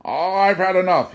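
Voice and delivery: high, loud, breathless gasp of despair; gasping plea. A narrator's reading. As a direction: gasp it out, at the end of his rope. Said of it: "he gasped".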